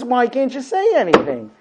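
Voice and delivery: wife pressing her silent husband; mock frustrated wife. A wife pressing her husband to talk, frustrated. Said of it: "She says".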